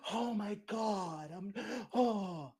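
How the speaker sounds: high, slow, theatrical gasps of dismay; fake gasping shock. Mocking performed grief at bad news, gasping slowly and high. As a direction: ham up a fake shocked mourner. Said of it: "doing what you believe is expected".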